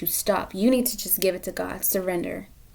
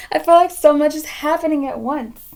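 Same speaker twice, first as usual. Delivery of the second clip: higher, louder overwhelmed voice; overwhelmed rush; 6 semitones higher, 6 dB louder than usual. Real, not acted. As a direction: sound swamped by it all. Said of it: "I feel discombobulated".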